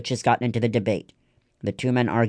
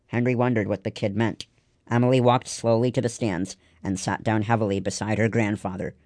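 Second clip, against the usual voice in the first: ordinary delivery, nothing marked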